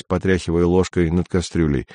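ordinary delivery, nothing marked

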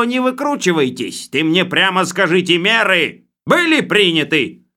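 high, loud, bossy bureaucrat voice; blustering official. Acted. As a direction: lay down the law like a boorish boss. Khlebovodov interrogates.

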